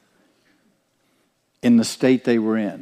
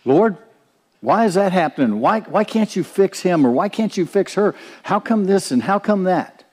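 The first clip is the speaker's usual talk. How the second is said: higher-pitched pleading complaint, quick at first; demanding, whiny prayer asking why; Martha approach; 7 semitones higher than usual. Retold or imitated. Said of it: "that's the Martha approach".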